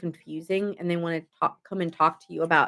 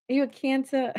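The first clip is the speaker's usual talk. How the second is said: silly sing-song voice; 5 semitones higher than usual. Pretend. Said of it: "I don't know why I'm talking like that".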